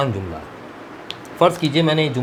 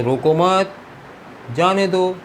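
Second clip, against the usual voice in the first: "रोको मत" as one unit, pause, then "जाने दो" raised; pause after मत; 6 semitones higher than usual. Shown as a demonstration. Teacher shows where the guard put the punctuation, pausing after मत. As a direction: join रोको to मत and break before जाने दो. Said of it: "उसने punctuation यहाँ लगाकर पढ़ा".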